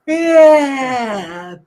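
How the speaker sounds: big drawn-out yawn; exaggerated yawn. Shown as a demonstration. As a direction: yawn it out loudly. Leading a group yawn.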